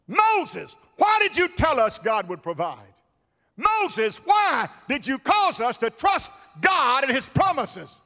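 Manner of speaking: high-pitched pleading reproach to Moses; acted panicky blame from a trapped people; accusing complaint. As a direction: call out his name, then demand why.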